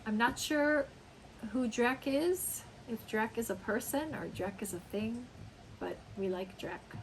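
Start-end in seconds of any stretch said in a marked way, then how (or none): none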